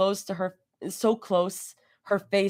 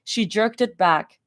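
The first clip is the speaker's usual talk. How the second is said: plain reading voice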